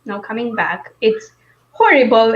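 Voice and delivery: ordinary talk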